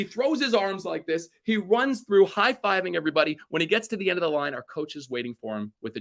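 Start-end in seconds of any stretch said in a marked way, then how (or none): none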